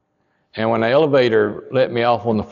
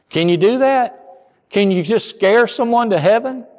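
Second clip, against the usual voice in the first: incredulous question; 11 semitones higher, 3 dB louder than usual. Acted. Preacher's rhetorical question.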